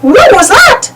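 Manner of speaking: high Mickey voice; lightly voiced Mickey asking. A narrator's reading. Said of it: "Mickey asked".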